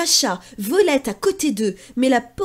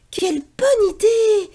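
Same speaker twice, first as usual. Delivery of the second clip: high, loud delighted cry; excited exclamation; 9 semitones higher, 5 dB louder than usual. Acted. Sonia the duck loves the idea, high and loud. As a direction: exclaim with glee. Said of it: "s'écrie Sonia".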